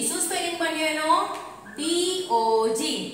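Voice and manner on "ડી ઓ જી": slow, drawn-out letters; each letter stretched out; spelling out. Shown as a demonstration. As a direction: spell it slowly for young pupils. Teacher spells word for pupils.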